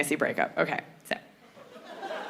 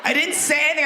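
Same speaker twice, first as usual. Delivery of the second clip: higher-pitched, defensive denial; mock defensive protest; 6 semitones higher, faster than usual. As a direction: protest your innocence, pitched up. Acted guy protesting after her shriek.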